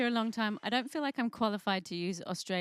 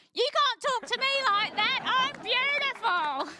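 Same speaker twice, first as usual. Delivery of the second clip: much higher, louder indignant Aussie screech; squawking Aussie Sheila; 5 dB louder than usual. Pretend. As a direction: squawk it like a parrot. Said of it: "she opens her mouth and goes".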